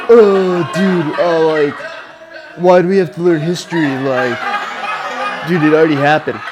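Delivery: lightly hammed-up complaining student; mock teenage griping; whiny high school voice. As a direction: whine like a put-upon high schooler. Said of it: "my complaining, whiny high school, uh, voice".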